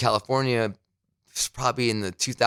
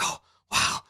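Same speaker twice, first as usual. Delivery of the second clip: stunned whisper; 4 dB quieter than usual. Retold or imitated. Retells his reaction to the bosses' "okay.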